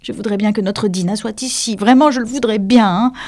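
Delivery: high-pitched wistful Alice; wishing aloud. A narrator's reading. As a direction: think aloud to nobody in particular. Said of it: "dit à haute voix".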